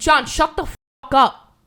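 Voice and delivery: loud, abrupt shut-down of an interrupting friend; really fed up and loud; exasperated outburst. Real, not acted. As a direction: raise your voice to cut them off.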